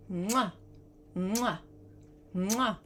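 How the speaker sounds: three short smacking kiss sounds; blown kisses. Acted. Witch blowing kisses to the magic pot, smacking. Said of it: "blow three kisses".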